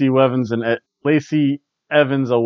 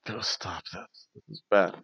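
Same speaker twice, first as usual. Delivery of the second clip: whispered aside; 7 semitones lower, 11 dB quieter than usual. Retold or imitated. Imagined crew member whispering to Becky to stop the match. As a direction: whisper it like a backstage warning. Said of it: "someone said something to Becky".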